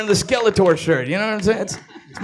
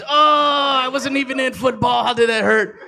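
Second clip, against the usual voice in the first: put-on hurt whiny boyfriend voice; 4 semitones higher than usual. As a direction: whimper it out, deeply hurt.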